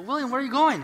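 high, loud puzzled call after someone leaving. Acted. Soldiers calling to William Wallace as he rides out, calling out. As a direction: shout a question after a rider.

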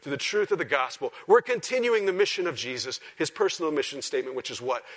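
loud, high preaching voice; full-force preaching. Real, not acted. Preacher explaining Scripture to his church, preaching at full force.

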